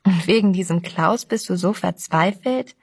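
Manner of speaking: ordinary delivery, nothing marked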